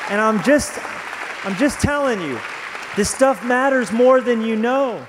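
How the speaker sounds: rising passionate preacher voice; preaching at full force. Acted. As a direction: preach it home to the congregation. Preaching climax.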